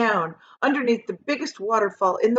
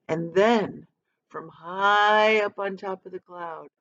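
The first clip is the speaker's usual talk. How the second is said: ordinary delivery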